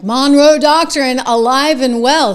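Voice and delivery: loud, slow proclamation; real emphatic boast; triumphant declaration. Real, not acted. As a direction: plant a flag with every word.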